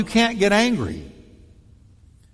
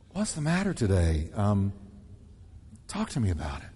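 much softer and lower, tender; gentle concern; 9 semitones lower, 8 dB quieter than usual. Shown as a demonstration. Pastor demonstrates a loving tone to the congregation. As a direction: draw them out softly and kindly. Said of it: "Or the tone you use".

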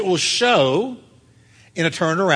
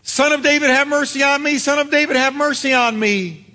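crying out; 8 semitones higher than usual. Retold or imitated. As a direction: call out over a crowd for mercy. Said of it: "to scream out from the crowd".

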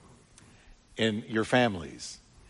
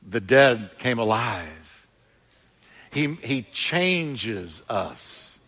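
measured, slow sermon build; slow preaching cadence; much slower than usual. Acted. Preacher telling the congregation of changes, slow cadence. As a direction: lay each line down slowly.

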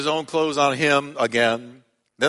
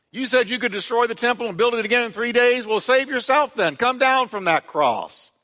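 taunting; 8 semitones higher than usual. Retold or imitated. Passersby taunting Jesus on cross.

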